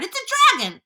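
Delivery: very high panicked cry; alarmed shouting. A narrator's reading. A policeman names the monster, shouting in panic. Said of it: "all started shouting at once".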